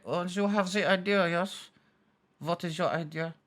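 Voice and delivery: soft, put-on European accent; quietly curious European-accented delegate. EU delegates answering Germany's idea, soft European accent. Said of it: "And they'll be like".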